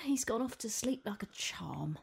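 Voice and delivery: hushed relieved whisper. Real, not acted. Child asleep nearby.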